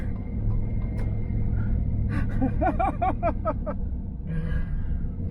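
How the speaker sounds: high-pitched drawn-out cackle; gloating high cackle; long high laugh. Real, not acted. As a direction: laugh long and high at your own story. Gloating after his charm story.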